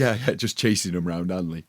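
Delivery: ordinary talk, nothing marked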